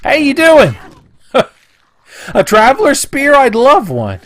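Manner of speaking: high, loud fake-cheerful voice; high, loud put-on cheer; mock friendly greeting. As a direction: chirp at the traveler, high and loud. Mock cheerful to game character.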